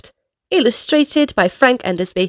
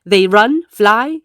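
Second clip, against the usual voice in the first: usual speaking voice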